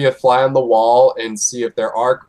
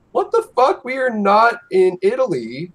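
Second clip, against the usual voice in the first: much higher, incredulous outburst; a half-mocking what-the-hell squawk; exasperated disbelief; 11 semitones higher than usual. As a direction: blurt it out like you can't believe your ears. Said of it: "I'm like".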